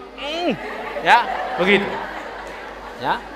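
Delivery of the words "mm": high, soft squeal of "mm" through closed lips; mock swooning exasperation, high-pitched hum; gemes grunt. Preacher acting women cutting themselves at Yusuf's beauty, gemes hum. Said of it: "sambil gemes".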